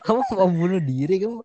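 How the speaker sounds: high, loud mock scolding; a hammed-up angry teacher scolding. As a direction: scold a kid for playing on the railing. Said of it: "terus gua dimarahin".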